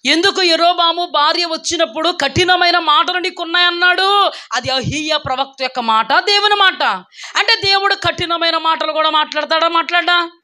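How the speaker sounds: high, sustained preaching voice; insistent pulpit questioning. Real, not acted.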